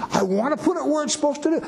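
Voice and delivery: high, fast eager king; eager David voice. Retold or imitated. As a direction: rush it out like a proud king. Acting out David's eager words.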